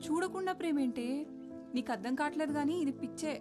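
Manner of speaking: high-pitched dismissive protest; incredulous scolding. Acted. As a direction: scoff at the friend's love in disbelief. Friend dismisses love as madness.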